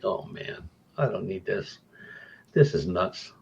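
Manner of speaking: spooked, soft and breathy under the breath; muttered to himself. Retold or imitated. Spooked investigator muttering to himself beside the doll. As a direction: mutter it like you want out.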